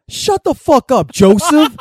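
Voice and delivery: high, loud, drawn-out bellow; yelling dad. Retold or imitated. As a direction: holler through a screen door. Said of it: "I hear from the screen door".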